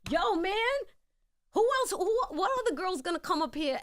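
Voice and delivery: loud, high, exasperated demand; mad at him. Retold or imitated. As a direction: shout the question at him, fed up. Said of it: "I was getting mad at him".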